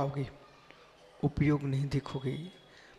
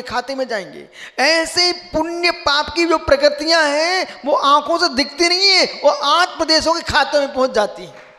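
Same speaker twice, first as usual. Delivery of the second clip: loud, high-pitched sermon delivery; impassioned preacher, voice raised high; full-force preaching; 9 semitones higher, 9 dB louder than usual. Real, not acted. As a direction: proclaim the teaching forcefully to the whole assembly.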